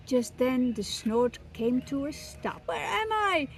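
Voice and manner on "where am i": high, alarmed chick; frightened baby bird. Acted. Baby bird carried off by the snort, high and scared. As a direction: panic a little, pitched up.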